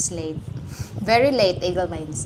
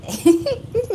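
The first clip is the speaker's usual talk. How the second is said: high squeal of laughter; 14 semitones higher, 5 dB louder than usual. Real, not acted. Laughing over the time confusion.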